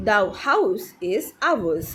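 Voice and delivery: very slow, separated words; deliberate teacher's example reading; repeat-after-me model. Shown as a demonstration. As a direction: model it slowly for learners to copy. Teacher models sentence for repetition.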